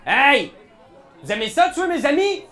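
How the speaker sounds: loud, high angry yell; yelling across the room. Acted. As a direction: shout the accusation across the bar. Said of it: "fait juste crier à travers la pièce".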